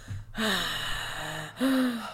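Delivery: long, audible open-mouthed breaths; slow heavy breathing, not wheezing. Retold or imitated. Dwight mouth breathing at the meeting, heavy breaths. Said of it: "Dwight is mouth breathing".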